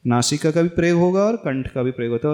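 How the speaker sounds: usual speaking voice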